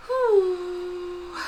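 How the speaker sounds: a high, loud puff of relief; relieved whew. Real, not acted. After listing the party details, a high relieved whew. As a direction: blow out a whew of relief after a long spiel.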